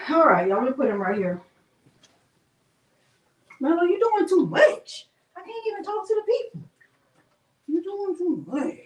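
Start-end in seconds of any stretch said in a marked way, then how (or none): 3.6-8.7 high scolding dog talk. Acted.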